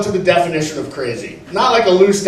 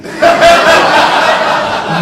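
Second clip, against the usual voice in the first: breathy laugh; 9 semitones higher, 4 dB louder than usual. Real, not acted. Laughs at own joke.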